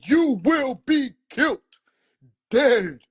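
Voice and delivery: uncle with a pistol; uncle's threat acted.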